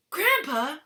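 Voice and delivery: high, loud eager call to grandpa; excited cry. Acted. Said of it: "cried Charlie".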